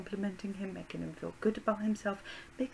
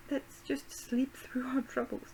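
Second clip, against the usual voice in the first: whispering sim voice; 4 semitones higher, slower than usual. Pretend. Voicing her sim, whispered.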